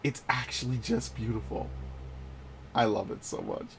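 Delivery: soft, sinking into adoration; hushed awe. Real, not acted. Player admiring a beautiful sight in the game, hushed awe. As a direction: gush quietly, almost to yourself.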